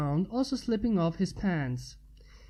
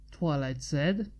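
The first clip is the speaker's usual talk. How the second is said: ordinary delivery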